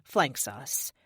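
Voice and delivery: ordinary delivery, nothing marked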